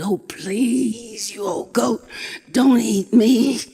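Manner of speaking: a scared cat pleading, swelling on the last plea; begging. Acted. Wildcat on his knees begging the goat, frightened. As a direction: beg for your life, slowing down at the end. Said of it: "He begged and he pleaded".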